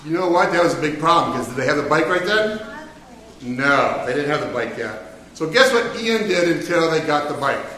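normal talking voice

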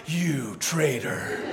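very slow, low, hushed accusation; mock betrayed accusation. Dad voicing his baby accusing him, slow low mock betrayal. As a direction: draw it out softly with hammed-up betrayal. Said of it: "she's just like".

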